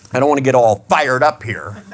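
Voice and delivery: normal talking voice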